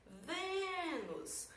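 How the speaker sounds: Vênus with VÊ held long and high; stressed syllable drawn out. Shown as a demonstration. Teacher showing the tonic syllable, VÊ drawn out. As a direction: stretch a high VÊ, drop on nus. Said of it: "Acabei de encontrar minha sílaba tônica".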